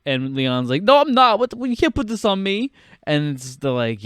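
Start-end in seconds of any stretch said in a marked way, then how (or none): 0.8-2.7 high-pitched, hurried denial; mock indignant protest. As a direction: protest the accusation in a raised voice. Acting out Leon protesting.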